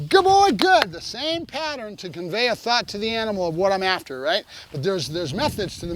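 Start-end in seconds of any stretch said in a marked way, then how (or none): 0.1-0.9 high, loud burst of praise; excited dog praise. Real, not acted. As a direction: reward the dog with your voice. Praising dog after release marker.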